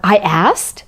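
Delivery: ordinary delivery, nothing marked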